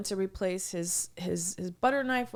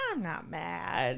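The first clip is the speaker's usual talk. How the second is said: slow, lower and softer reply; smiling denial; 4 semitones lower, 5 dB quieter, slower than usual. Retold or imitated. Customer answers server's challenge, calm smiling denial. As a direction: imitate someone calmly denying it with a slight smile. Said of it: "he ki-, kinda smiles".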